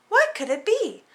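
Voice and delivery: ordinary delivery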